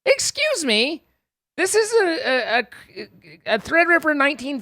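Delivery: mock offended. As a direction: play outraged at the slander. Mock offended at chat's claim.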